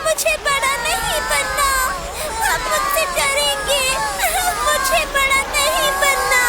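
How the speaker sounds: put-on high, childlike tearful wailing; crying. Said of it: "रोना बंद करो".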